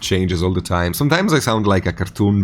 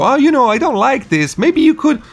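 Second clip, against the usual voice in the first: high-pitched, tentative mock politeness; put-on noncommittal critique; mock middle ground; 3 dB louder than usual. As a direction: imitate someone gently hedging, pitched way up. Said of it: "she doesn't go middle ground".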